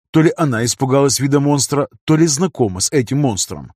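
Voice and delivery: ordinary delivery, nothing marked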